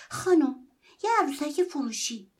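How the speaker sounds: high, loud plea to a stranger; boy stops a woman shovelling snow and calls his sale; calling out. Acted. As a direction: call out to stop her.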